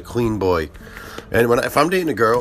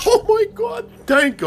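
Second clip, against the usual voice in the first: very high gushing thanks; grateful customer. Retold or imitated. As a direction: squeal it gratefully.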